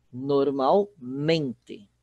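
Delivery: Normalmente drawn out, stress moved to MEN; very slow Normalmente, landing on "men". Shown as a demonstration. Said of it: "a sílaba tônica vira o men". ("Normalmente" bem devagar, com a tônica no "men")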